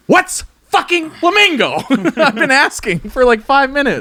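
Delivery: high, loud and jittery; buzzing on too much coffee; wired on coffee. Real, not acted. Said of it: "You are wired on coffee".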